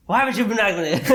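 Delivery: laughing through the question. Retold or imitated. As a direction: laugh it out in mock disbelief. Mock-exasperated question to patient.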